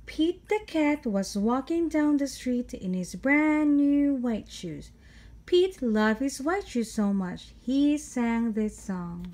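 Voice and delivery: lively, sing-song picture-book read-aloud; reading to children. A narrator's reading. Storyteller reading Pete the Cat to kids, lively. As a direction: read it like storytime for little ones.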